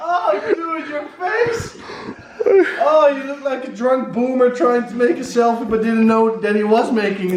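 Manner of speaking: high squealing laughter; cracking up while talking; laughing hard. Real, not acted. Laughing at friend's face.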